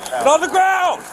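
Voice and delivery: high, furious commands; shouted surrender demands. Real, not acted. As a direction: yell it over and over, rising. Officer orders suspect down.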